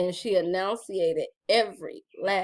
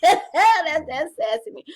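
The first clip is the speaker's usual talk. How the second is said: louder and laughing, slowed down; 9 semitones higher, 9 dB louder than usual. Real, not acted. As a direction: laugh proudly at your sassy side.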